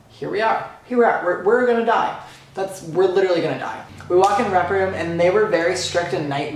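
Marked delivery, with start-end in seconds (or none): 0.1-2.2 reenacted nervous freak-out, pitch climbing; building giddy panic. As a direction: escalate into excited panic. Panic building at rep room.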